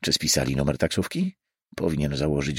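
usual speaking voice

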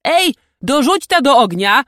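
high, loud call to servants; shouted order. A narrator's reading. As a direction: holler an order across the camp. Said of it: "krzyknął w stronę pachołków".

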